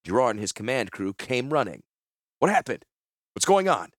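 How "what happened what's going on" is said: high, loud urgent questions; alarmed demand. A narrator's reading. Commander running in after a shot, high alarmed shout. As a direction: call out in alarm, pitched well up.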